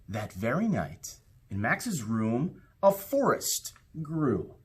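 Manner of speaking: put-on lively read-aloud for children, rising with the adventure; storybook narration. A narrator's reading. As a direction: tell it like a bedtime story to a class. Reads picture book aloud.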